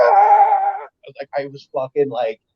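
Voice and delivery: usual speaking voice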